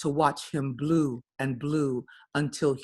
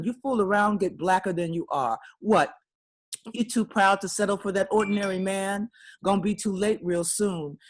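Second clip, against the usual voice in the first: higher, quick nagging auntie voice, half-mocking the lecture; scolding elder; 5 semitones higher than usual. Poet voicing an elder scolding a proud young woman, quick and nagging. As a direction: fuss at her like a disapproving older woman.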